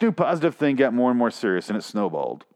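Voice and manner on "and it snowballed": usual speaking voice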